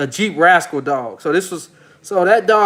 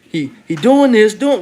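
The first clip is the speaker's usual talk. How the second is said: imitating [name]; 4 semitones higher than usual. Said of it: "[name] say".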